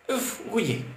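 put-on a small boy interrupting, worried; worried child. A narrator's reading. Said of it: "la coupa Tidji d'un ton inquiet".

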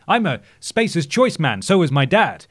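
upbeat brainwashed company man; corporate slogan voice. Retold or imitated. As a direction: sell it like a cheesy ad. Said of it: "they're always just dropping slogans".

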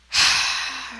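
long breathy sigh. Real, not acted. Player fails to find the key card, long sigh. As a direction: let out a long exhale.

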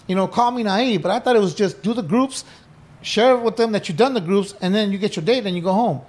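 ordinary talk

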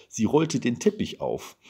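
ordinary delivery, nothing marked

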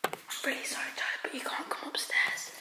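frightened hushed whisper; scared whisper. Real, not acted. Sneaking inside to tell parents, scared whisper. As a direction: whisper so the parents don't wake. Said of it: "I'm scared".

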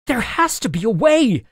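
high-pitched, desperate protest; agitated wail. A narrator's reading. Boy trapped by unbroken walls, agitated wail. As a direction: wail it in mild panic. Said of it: "I wailed, my voice cracking in my agitation".

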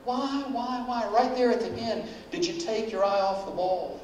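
Jesus coaxing Peter like a fond parent; gentle parental Jesus. Pretend. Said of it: "he said".

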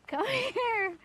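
high call with a laugh in it; high-pitched coaxing. Real, not acted. Owner coaxing Beetle along, high laughing call. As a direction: coax the pet high, half laughing.

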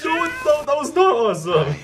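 high-pitched amused objection; emphatic protest. Real, not acted. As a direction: protest it with a laugh in the voice. Objecting to the film character.

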